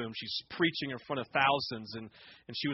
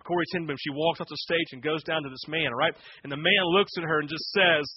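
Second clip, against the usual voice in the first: ordinary talk, nothing marked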